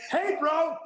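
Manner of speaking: high mock-alarmed call-out; retold alarmed protest. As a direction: object as if someone is creeping into your house. Retold protest at house photos.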